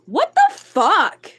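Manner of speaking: loud, high, drawn-out disbelief; shocked outburst. Real, not acted. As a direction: cry out slowly in real shock. Streamer reacts in shock to chat.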